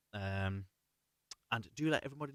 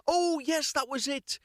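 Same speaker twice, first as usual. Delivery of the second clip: sudden recall; 14 semitones higher, 4 dB louder than usual. Real, not acted. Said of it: "suddenly remembers the ravens".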